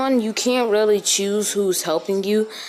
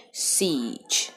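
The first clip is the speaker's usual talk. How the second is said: dramatic whisper; 4 semitones lower, 5 dB quieter than usual. Acted. Dramatic reveal of mode name.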